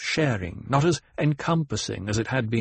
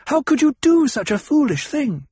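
very high, loud scolding; outraged demand; 6 dB louder than usual. A narrator's reading. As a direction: demand an answer, voice soaring. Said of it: "she demanded".

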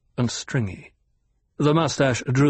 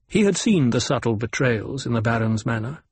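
ordinary talk

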